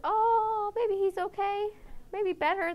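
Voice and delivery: high, hopeful mock voice; imitating the audience. Audience judging dog four, hopeful high imitation. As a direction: play the crowd talking itself into relief. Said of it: "where we all said".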